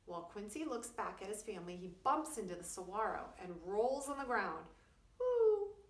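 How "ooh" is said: high, loud laughing hoots; chuckling owl hoots. A narrator's reading. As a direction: hoot with amusement like an owl. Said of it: "chuckles Mister Owl".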